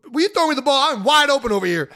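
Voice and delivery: loud, high yelling; retold yell at quarterback. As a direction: call for the ball across the field. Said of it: "And I yell at him".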